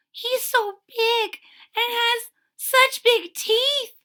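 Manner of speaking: high, slow, scared little voice; timid kitten fearing the dog; frightened kitten. A narrator's reading. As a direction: tremble it out like a tiny kitten. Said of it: "she whispers".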